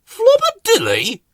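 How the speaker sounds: high mocking squeak. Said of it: "said Scamper".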